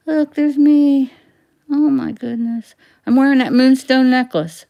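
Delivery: thrilled to find herself in the picture; delighted surprise. Real, not acted. Seeing her own old photo, high loud delight.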